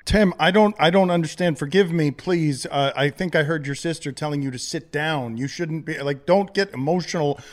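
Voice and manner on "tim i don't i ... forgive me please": flustered pleading. Acted. Host baffled by caller's story.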